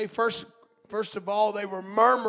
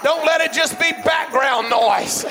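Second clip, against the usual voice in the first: high, loud exhortation from the pulpit; full-force preaching; 6 semitones higher, 6 dB louder than usual. Real, not acted. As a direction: plead with the congregation at a shout.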